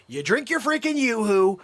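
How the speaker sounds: ordinary talk, nothing marked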